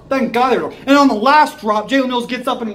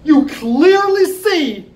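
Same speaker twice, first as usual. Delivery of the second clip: very slow, higher, pointed; slow accusation; 5 semitones higher, 3 dB louder, much slower than usual. Real, not acted. Fan accusing the coaches, very slow and pointed. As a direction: spell it out slowly.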